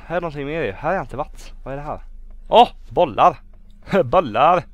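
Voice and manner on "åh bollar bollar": loud, delighted shouts; excited exclamation. Real, not acted. Player spots balls in the game, shouting excitedly. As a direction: call it out louder on each find.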